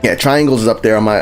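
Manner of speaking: ordinary delivery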